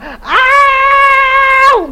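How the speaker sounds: high loud howl; woman's screamed ow; imitated scream. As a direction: cry out as if in pain.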